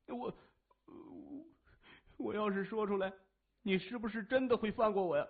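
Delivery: a coward begging not to be killed; frightened stammer. A narrator's reading. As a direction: captive stammering to his captor, pleading in fear. Captive stammers begging for life.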